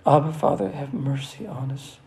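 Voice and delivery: steady, earnest prayer voice. Acted. Pastor praying over the congregation in an earnest voice.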